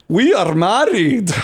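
hammed-up imitation of an Eastern European man; retold proud couple. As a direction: announce it slowly, swooping up. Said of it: "And they were like".

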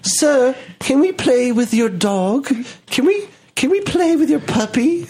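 put-on high-pitched little British child voice; mock British kids. Kids asking to play with the dog, mock British child voice. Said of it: "these two little British kids".

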